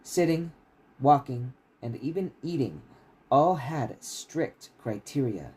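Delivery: steady, measured reading of a fantasy novel; story narration. A narrator's reading. Author reading his novel to stream listeners, steady narration. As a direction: read the chapter out like an audiobook.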